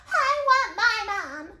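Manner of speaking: put-on loud, high, drawn-out child's yell; high wail stretched over each word; yelling little princess. A narrator's reading. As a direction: yell it like a demanding little girl. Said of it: "yelled the little princess".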